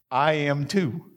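ordinary talk

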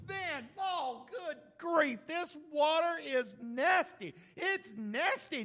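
high, drawn-out mock disgust; acted disgust. Thirsty Israelite tasting bitter water, mock disgusted. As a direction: play it repulsed, stretching the words high.

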